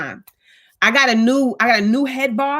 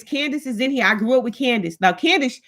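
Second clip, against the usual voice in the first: ordinary talk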